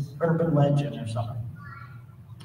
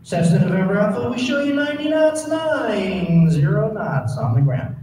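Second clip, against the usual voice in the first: cool controller voice; 3 semitones higher, 4 dB louder than usual. Retold or imitated. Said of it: "talk to him like he's Air Force One".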